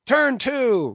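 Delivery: very high, loud roar; captain roaring his final order. Acted. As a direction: bellow the order. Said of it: "roared the captain".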